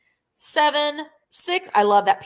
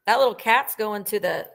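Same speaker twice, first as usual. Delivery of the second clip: usual speaking voice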